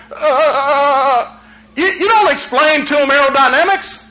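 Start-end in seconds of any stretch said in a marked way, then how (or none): 0.1-1.4 put-on scared kid stammer. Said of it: "They're like".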